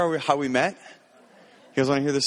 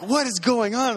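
startled; 10 semitones higher than usual. Retold or imitated. Startled by a scream.

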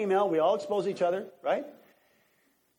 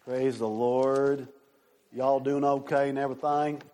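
exaggerated calm; 4 semitones lower than usual. Shown as a demonstration. Preacher checking on congregation, mock calm. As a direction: hold the voice down, mock serene. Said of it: "lower my voice and be real calm".

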